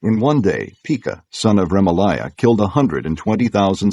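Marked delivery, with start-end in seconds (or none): none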